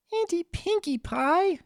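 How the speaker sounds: a tiny filly calling for her aunt; crying out. Acted. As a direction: call to her aunt for rescue like a small child. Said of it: "she cried".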